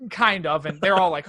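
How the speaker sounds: normal talking voice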